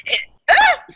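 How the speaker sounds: laughing too hard to finish; helpless laugh. Real, not acted. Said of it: "I cannot stop myself".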